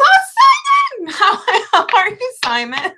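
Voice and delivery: squealing high shout of delight; real excited squeal at a viewer; fangirling. Real, not acted. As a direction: greet a favourite viewer like a starstruck fan. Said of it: "I just fangirled a little bit".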